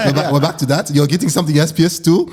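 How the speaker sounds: put-on incredulous Nigerian-accented parent voice; imitated Nigerian parent. Parents question their son about getting waxed, Nigerian accent.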